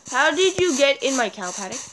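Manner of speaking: ordinary delivery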